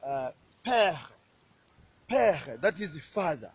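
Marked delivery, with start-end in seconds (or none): 0.6-2.6 père said pehr, throaty r; père the French way. Shown as a demonstration. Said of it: "you just say".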